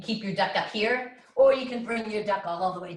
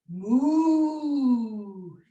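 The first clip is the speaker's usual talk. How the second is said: mooing cow; 3 semitones higher, 6 dB louder than usual. Pretend. Kids yoga animal sounds.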